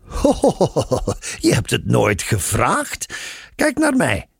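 high, playful ho-ho-ho protest; teasing. A narrator's reading. Nun needling Simon for never having asked, teasing. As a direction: tease with mock innocence, voice lifted. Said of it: "zei ze plagerig".

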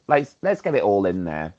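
ordinary talk

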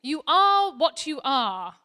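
slow, loud, firm mother owl; loud, slow, firm mummy owl to her chick. Acted. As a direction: lay down the law gently, slowly. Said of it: "his mummy said firmly".

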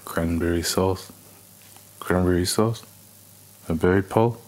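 put-on low, quiet [name]-style Liverpool accent. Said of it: "in his accent".

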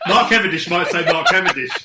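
usual speaking voice

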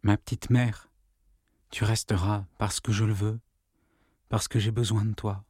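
soft, low plea; whispered into her ear. Acted. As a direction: murmur close to her ear. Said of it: "il lui dit tout bas dans l'oreille".

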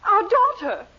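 high, loud insistence; exasperated reminder. Acted. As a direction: make him remember, exasperated. Wife reminds husband of daughter.

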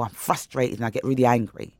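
normal talking voice

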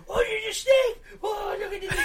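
squealing, very high mock cooing; Rob's stupid voice. Storyteller mimics his friend cooing at a snake, stupid high voice. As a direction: put on a silly high voice for the excited snake-wrangler. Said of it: "he's making all these stupid voices".

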